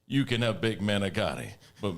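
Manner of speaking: pumped-up TV pitchman, loud and bright; infomercial. Pretend. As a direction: sell it like a late-night TV ad. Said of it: "let's do an infomercial for Spaghetti World".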